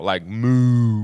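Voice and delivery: "moo" drawn out like a cow's moo; moo sound. Shown as a demonstration. Hosts explaining Southern cow to listeners, mooing "moo". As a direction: say "moo" long and round, like the animal. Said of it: "deep Southern accents".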